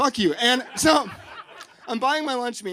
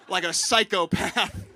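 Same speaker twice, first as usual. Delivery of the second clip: ordinary delivery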